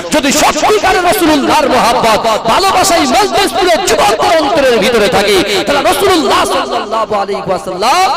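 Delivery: loud, high surge of fervour; impassioned preaching. Real, not acted. Preacher urging the youths to love the Prophet, impassioned. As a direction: cry it out to the whole crowd.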